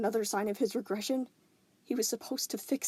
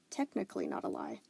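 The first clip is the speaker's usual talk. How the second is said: usual speaking voice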